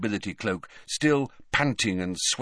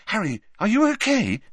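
high, louder worried question; anxious; 12 semitones higher, 5 dB louder than usual. A narrator's reading. Girl checking on her friend, anxious. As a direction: ask in anxious concern. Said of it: "she added anxiously".

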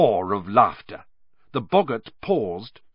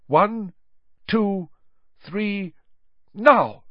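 raised, measured count bursting into a loud "now"; countdown to a command; 8 semitones higher, 3 dB louder, much slower than usual. Acted. Professor counting pupil in to cast a spell, building countdown. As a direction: count them in, then punch the command.

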